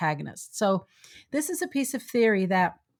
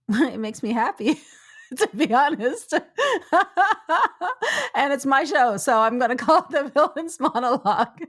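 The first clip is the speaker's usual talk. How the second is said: laughing through the words, higher pitch; 5 semitones higher, 4 dB louder than usual. Real, not acted. Host admits naming the theory to please herself, laughing. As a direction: confess it through real giggles. Amused admission about her own term.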